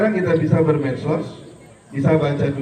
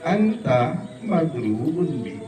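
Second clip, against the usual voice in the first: slow Arabic; much slower than usual. Shown as a demonstration.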